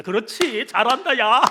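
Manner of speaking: gleeful devil. Retold or imitated. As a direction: egg them on like a delighted devil. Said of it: "마귀가 들러붙습니다". (신난 마귀 흉내로 부추기며)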